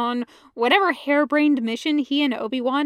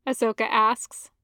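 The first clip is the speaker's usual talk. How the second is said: ordinary delivery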